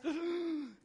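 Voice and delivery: sharp high gasp of being caught; startled gasp. Pretend. Caught-out sinner gasping at the Lord's return. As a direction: gasp like you got caught red-handed.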